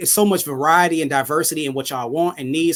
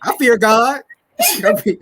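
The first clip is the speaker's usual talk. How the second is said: laughing; 7 semitones higher, 3 dB louder than usual. Real, not acted. Laughing at scenario.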